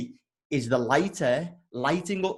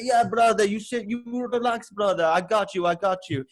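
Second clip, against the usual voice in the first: amateur Russian driver voice, pitched up; bad Russian accent; 6 semitones higher than usual. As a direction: reassure a passenger in clumsy Russian accent. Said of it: "I've got this Russian taxi driver".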